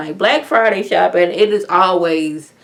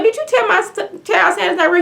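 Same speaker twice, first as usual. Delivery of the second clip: shrill, much higher, confronting; angry mom; 12 semitones higher than usual. Retold or imitated. Mother confronting the paramedic over Santa, high and indignant. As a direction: storm up as an offended mother. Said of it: "one of the mamas coming like".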